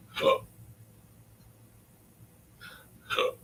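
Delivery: hiccuping between words; catching a hiccup. Real, not acted. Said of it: "Now I got the hiccups".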